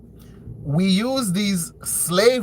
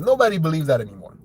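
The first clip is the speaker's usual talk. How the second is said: normal talking voice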